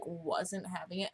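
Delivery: normal talking voice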